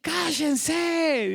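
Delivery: silencing the crowd. Acted. Caleb hushing the shouting people before Moses, calling out. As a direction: call it out high, as if over a clamour. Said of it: "Caleb hizo callar al pueblo".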